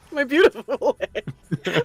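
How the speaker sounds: slow, laughing echo of my beautiful hair. Real, not acted. Co-host echoes the hair joke, laughing. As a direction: chuckle through each word.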